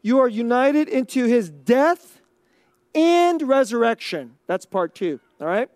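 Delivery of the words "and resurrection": "and" set apart and stretched; emphatic, lengthened "and" after a pause; stress on "and". Shown as a demonstration. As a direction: lean hard on the word "and". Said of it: "I wanna put emphasis on the word and".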